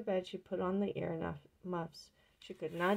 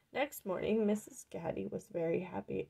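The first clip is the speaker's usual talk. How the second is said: ordinary delivery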